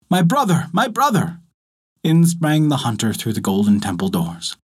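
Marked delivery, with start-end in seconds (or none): 0.1-1.4 high-pitched searching call; calling out loud. A narrator's reading. Hunter calls for his missing brother, high urgent cry. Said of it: "A voice called loud".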